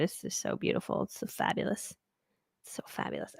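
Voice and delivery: very soft, nearly whispered; hushed admiring aside. Real, not acted. Coach murmuring over the image she found, hushed. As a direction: murmur it under your breath.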